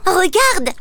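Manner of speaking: high excited call; exclaiming. Acted. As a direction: point it out with excitement. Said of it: "s'exclame sa sœur". (exclamation aiguë et enjouée)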